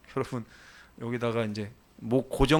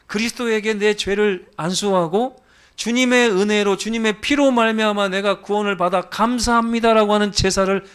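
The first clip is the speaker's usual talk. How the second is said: raised, high-pitched sermon voice; impassioned preaching; 8 semitones higher, 4 dB louder than usual. Real, not acted. Preacher urging congregation to offer thanks, impassioned preaching. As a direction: drive the message home with fervor.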